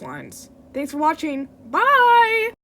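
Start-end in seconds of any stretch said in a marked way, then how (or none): 1.7-2.5 loud, high, stretched goodbye; bright sign-off. Real, not acted. Creator ending the video, loud high goodbye. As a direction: call out a big farewell.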